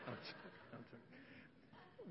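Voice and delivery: breathless whispered laugh through the words. Real, not acted. Pastor laughing off his joke to the congregation, whispered. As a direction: giggle it out under your breath.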